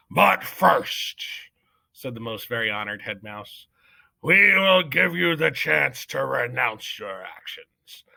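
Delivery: slow, weighty, commanding; head mouse demanding Despero repent; solemn judge. Acted. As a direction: offer mercy like a decree.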